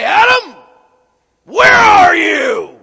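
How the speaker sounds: furious booming shout, slowed; angry God. Pretend. Preacher imagining an angry God yelling for Adam. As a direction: roar it like an enraged God. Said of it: "does the scriptures give voice inflection".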